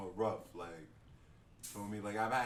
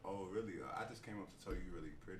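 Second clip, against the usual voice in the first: soft, low flirty voice; sexy voice; 5 semitones lower, 8 dB quieter, much faster than usual. Retold or imitated. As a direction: croon it like a smooth flirt. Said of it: "You see how you put on the sexy voice".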